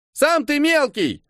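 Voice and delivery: put-on high, drawn-out indignant kid; indignant child. A narrator's reading. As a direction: retort like an offended child, slow and high. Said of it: "возмущённый детский голос".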